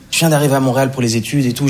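ordinary talk

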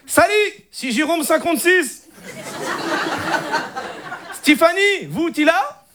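high-pitched mock Moroccan accent; eager chat-up in a hammed-up Moroccan accent. Cousin flirting online as a Frenchman, thick Moroccan accent. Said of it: "l'accent arabe".

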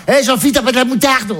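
high, casual call for mustard; mother at home. Retold or imitated. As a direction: shout across like she's at home. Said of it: "elle était comme à la maison".

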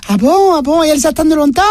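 put-on high little boy voice, far above his own; squeaky little boy asking his mum; child voice.

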